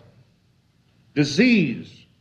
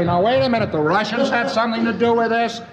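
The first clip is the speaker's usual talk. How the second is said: higher, insistent American objecting; mildly raised, listing the other nations in protest; mock protest; 7 semitones higher than usual. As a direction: push back like a defensive tourist. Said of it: "You say".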